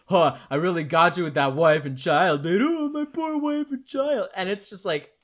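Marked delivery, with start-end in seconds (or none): 0.1-2.6 mocking villain taunt. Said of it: "he makes a point out of saying". 2.6-4.3 much higher, sarcastic whine of pity; villain mocking his own sob story. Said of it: "he makes a point out of saying".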